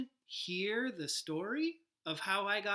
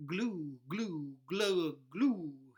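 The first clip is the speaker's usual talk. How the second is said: fish mouthing; 3 semitones lower, 3 dB louder, much slower than usual. Acted. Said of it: "he mouths".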